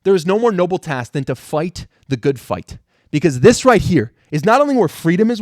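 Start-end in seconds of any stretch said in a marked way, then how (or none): none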